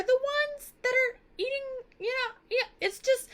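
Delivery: high-pitched can-you-believe-it about the suitors; high squeaky outrage. Real, not acted. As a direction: jump way up in pitch in disbelief. Teacher exclaims at suitors' hypocrisy.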